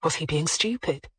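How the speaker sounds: ordinary delivery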